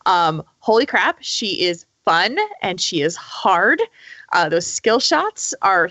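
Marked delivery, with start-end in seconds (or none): none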